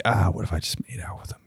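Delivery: fast, breathy whisper to yourself. Retold or imitated. Thinking about kissing weird old people, whispered. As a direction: murmur the intrusive thought under your breath. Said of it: "I'm like".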